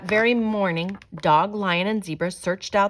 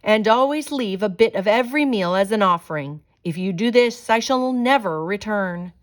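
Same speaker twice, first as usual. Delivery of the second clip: loud, higher commanding spirit voice; with authority; 3 semitones higher, 6 dB louder than usual. Acted. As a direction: proclaim it like a spirit giving orders. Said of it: "called out with authority".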